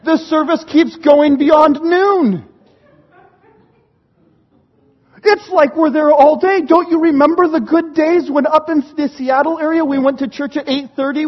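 hammed-up youngster's rant, pushed and insistent; mock outrage, building to a final wail; exasperated complaint. Son protests to dad about all-day church, mock exasperation.

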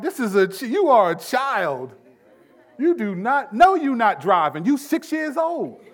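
high, loud, slowed-down mock outrage; indignant parent. Parent telling a child who is boss, comically indignant. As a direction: scold in comic disbelief, pitch jumping up. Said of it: "You kinda get indignant".

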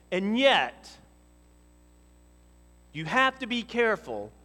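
loud, high, impassioned preaching; fiery pulpit crescendo; preaching at full force. Acted. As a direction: build the congregation up, voice ringing. Preaching builds to a climax.

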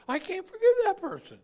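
high, loud mock objection; loud protest. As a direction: protest loudly, pitch jumping right up. Believer protests to Jesus.

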